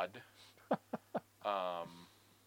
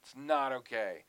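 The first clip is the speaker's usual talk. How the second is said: loud, higher-pitched mock outrage at a friend; mock exasperation; 4 semitones higher, 6 dB louder, slower than usual. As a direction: chew out your mate in exaggerated disbelief. Acted outburst at friend's slang.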